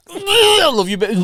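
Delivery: high, strained words through mock retching; hammed-up retch as you speak; gagging endearment. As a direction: act out choking back sick while cooing. Retelling retching over newborn son.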